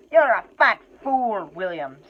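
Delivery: loud, high, scornful troll; a troll picking a fight; troll insult. Acted. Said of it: "said Bert".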